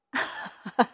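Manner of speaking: short laugh in character; acted chuckle. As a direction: laugh briefly, amused. Director laughs at the answer.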